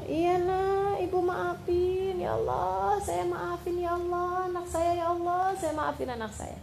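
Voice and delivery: forgive him gently through tears; tearful forgiveness. Retold or imitated. Mother tearfully forgives her son.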